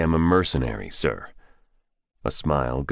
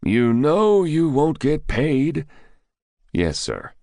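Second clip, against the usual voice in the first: ordinary delivery, nothing marked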